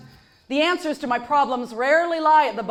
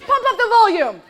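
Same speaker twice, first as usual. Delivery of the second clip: sarcastic indulgent mom; 5 semitones higher, 4 dB louder than usual. Retold or imitated. Mom to kids at morning, sarcastic permission. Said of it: "what they must hear".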